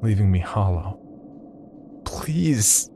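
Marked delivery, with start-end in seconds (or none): 2.0-2.9 broken whispered plea; begging whisper. A narrator's reading. Narrator begging the stranger, a broken whisper. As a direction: whisper it, hollowed out. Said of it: "I begged".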